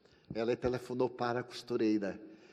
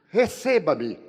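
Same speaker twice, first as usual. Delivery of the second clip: an urgent, louder plea to be received; 4 semitones higher, 8 dB louder, slower than usual. Retold or imitated. Pleading with the doctor. (súplica mais forte e insistente)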